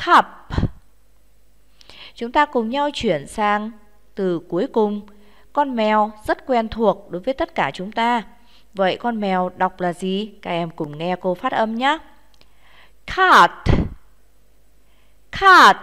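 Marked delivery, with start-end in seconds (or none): 0.1-0.3 Cup ending with a puff of air on P; aspirated P. Shown as a demonstration. Teacher models Cup for pupils, final P aspirated. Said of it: "Rất đúng rồi". 13.1-15.8 Cat, higher and louder, final T with a puff of air; aspirated T. Shown as a demonstration. Teacher models Cat for pupils, final T aspirated. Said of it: "phát âm bật hơi chữ T".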